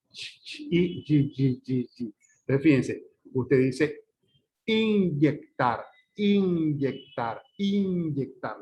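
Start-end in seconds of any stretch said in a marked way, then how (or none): 0.1-2.1 bare "ch" and "y" sounds fired one by one; repeated short palatal bursts of "ch", "g"; isolated palatal sounds. Shown as a demonstration. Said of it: "los fonemas son ch". 4.7-8.6 very slow "inyectar", long n melting into the y; drawn-out palatal "iny" in "inyectar"; palatalized n. Shown as a demonstration. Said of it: "se convierte también en un palatal más".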